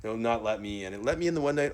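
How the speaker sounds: ordinary delivery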